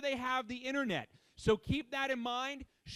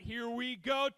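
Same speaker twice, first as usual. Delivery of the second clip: normal talking voice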